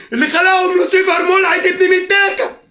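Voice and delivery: high furious shout; real outraged yell; enraged cursing. Real, not acted. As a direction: spit the curse out at a shout. Cursing in rage.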